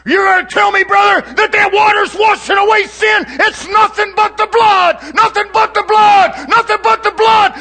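high, loud, shouting sermon delivery; preaching at full force. Acted. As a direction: preach it out to the whole congregation. Preacher cries out about the blood.

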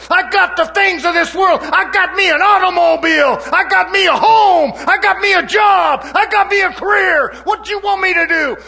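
preaching at full force as the rich man; defiant rich man shouting. Acted. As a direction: throw each line at God like a challenge.

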